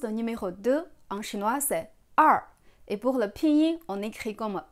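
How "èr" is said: "er" as "ar" with no French R; Mandarin er. Shown as a demonstration. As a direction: curl the tongue on "er", falling tone. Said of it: "prononce pas comme le R en français".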